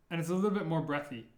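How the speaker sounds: normal talking voice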